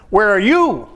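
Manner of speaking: "Where are you?" with YOU high and loud, then falling; stress on YOU. Shown as a demonstration. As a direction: lean hard on the word "you". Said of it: "How else could you read it?".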